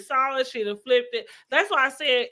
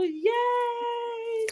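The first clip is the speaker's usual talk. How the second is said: high squealed cheer; excited cheer; 14 semitones higher, 5 dB quieter than usual. Real, not acted. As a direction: cheer it out, thrilled. Celebrating end of review.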